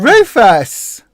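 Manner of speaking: loud call to the dog. Real, not acted. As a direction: shout the dog's name. Calling the dog.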